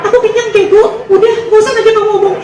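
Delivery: put-on high, grumpy girlfriend voice. Girlfriend snapping at her boyfriend in the car, high grumpy voice. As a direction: play the full, cranky girlfriend.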